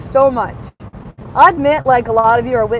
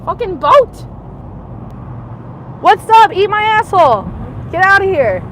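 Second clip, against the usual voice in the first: angry yelling; 5 semitones higher than usual. Real, not acted. Yelling angrily at people mocking her from a boat. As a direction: yell them off in real anger.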